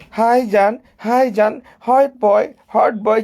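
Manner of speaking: high-pitched mock flirty greeting; gushing admirer. Mimicking a commenter's sweet greetings, high mock gushing. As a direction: imitate a gushing fan, high and loud.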